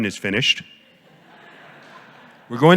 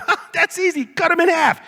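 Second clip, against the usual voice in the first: high, breezy mock king voice, much higher; mock Solomon. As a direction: toss it off like a flippant king. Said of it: "And Solomon's like".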